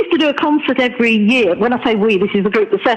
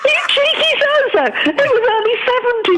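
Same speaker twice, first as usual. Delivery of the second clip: mock indignant; 12 semitones higher than usual. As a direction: tease back in mock outrage. Mock offence at being called old.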